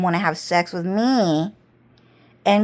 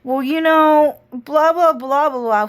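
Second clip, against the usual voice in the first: higher, breezy mock small talk; mocking customer chatter; 4 semitones higher, 3 dB louder than usual. To customers, a breezy mocking chatter. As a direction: toss off the blah blah lightly. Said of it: "I was like".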